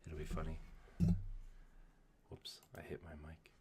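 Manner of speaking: hushed golf commentary voice; golf announcer whisper. Real, not acted. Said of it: "like in my golf voice".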